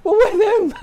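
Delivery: very high, loud yell breaking into laughter; gleeful mock cheer. Players instantly side with the party-loving manager, gleeful mock cheer. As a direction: squeal it out as the whole squad, laughing.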